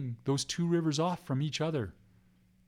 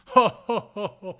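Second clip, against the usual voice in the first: loud, high ho-ho laugh; mock laughter; 4 semitones higher, 7 dB louder than usual. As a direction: laugh out loud like the mocking old spirit. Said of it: "Where's that laughter coming from?".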